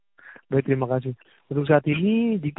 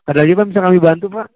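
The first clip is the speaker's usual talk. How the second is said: quick, loud scripted agent question; customer service voice; 4 semitones higher, 7 dB louder, faster than usual. Real, not acted. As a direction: rattle off the polite closing. Agent asks if anything else.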